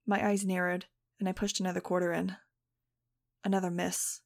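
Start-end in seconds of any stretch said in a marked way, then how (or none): none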